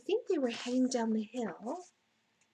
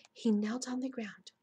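ordinary delivery, nothing marked

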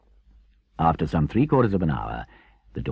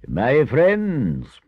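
raised, louder address to friends; formal opening of a speech; solemn Badger; 10 semitones higher, 7 dB louder than usual. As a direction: announce it grandly as the Badger.

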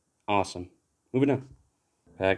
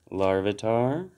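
ordinary talk

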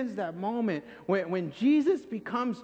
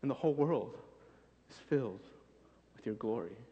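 hushed, fading into quiet awe; awed worship; 6 semitones lower, 3 dB quieter than usual. Retold or imitated. Worshipper in God's presence, fading to a hushed awe. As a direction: let the praise fall away softly. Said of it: "sing and be like".

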